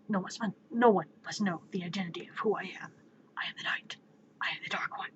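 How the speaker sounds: whispered, rasping cold-in-the-throat voice; Batman. Acted. Mysterious hero announcing who he is, strained whisper. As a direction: strain out a husky, secretive whisper. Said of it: "you strain your voice so we don't find out".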